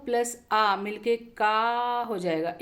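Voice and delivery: slowed down to spell out क plus आ; walk the class through it slowly; slow teacher's demonstration of का; slow explaining. Shown as a demonstration.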